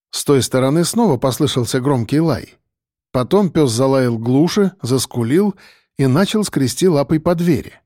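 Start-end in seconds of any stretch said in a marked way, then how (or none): none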